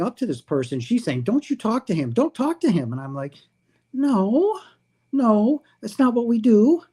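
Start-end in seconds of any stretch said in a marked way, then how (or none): 1.2-2.9 higher urgent woman's warning; a hint of a woman hissing a warning. Woman warning Jason not to talk to the stranger, higher voiced. 3.9-6.8 much higher insistent no; high protest. Retold or imitated. Jason telling the woman that's not what they do, high.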